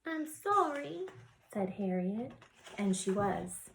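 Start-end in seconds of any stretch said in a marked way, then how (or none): 0.0-1.1 put-on high little-girl apology; apologetic child. Child Harriet apologises in the story.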